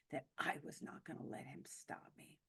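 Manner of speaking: soft, almost unvoiced defiance; quiet resolve. Shown as a demonstration. Survivor vowing to the audience not to be stopped, quiet and firm.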